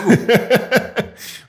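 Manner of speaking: a high, loud burst of real laughter. Real, not acted. Guest laughing at the host's "Oh", high loud laugh. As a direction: crack up at the host's reaction.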